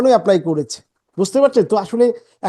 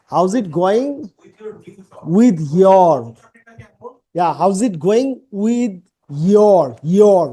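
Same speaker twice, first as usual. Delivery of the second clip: slow, drawn out, How's it linked; leisurely linked native phrasing; slow native model; much slower than usual. Shown as a demonstration. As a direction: stretch How's it going with your. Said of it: "How is it going with your new job".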